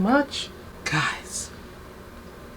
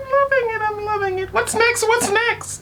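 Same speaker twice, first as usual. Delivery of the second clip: much higher, squealing with delight; bubbling over with glee, pitched way up; giddy excitement. Real, not acted. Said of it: "I'm excited".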